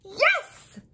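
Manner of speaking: high, loud joyful yelp; eager yes. Acted. New dog answers Jam, high joyful yes. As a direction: shout it out with pure joy.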